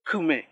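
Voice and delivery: "kume" with a subtle sixth vowel coloured by the K; vowel after consonant. Shown as a demonstration. Example for learners of vowel after K, careful demonstration. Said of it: "toma el sonido de la consonante".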